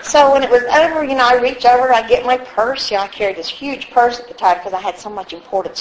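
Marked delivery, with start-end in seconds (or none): none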